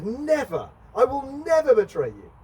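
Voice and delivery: adamant denial. Retold or imitated. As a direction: swear it with everything. Peter protests to Jesus.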